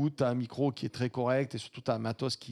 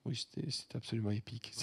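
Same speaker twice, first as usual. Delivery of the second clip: usual speaking voice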